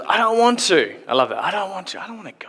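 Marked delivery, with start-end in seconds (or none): 0.1-0.9 high-pitched mock whine; refusing son. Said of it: "the son turns around and says". 1.4-2.5 high, soft mock whine; refusing son. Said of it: "the son turns around and says".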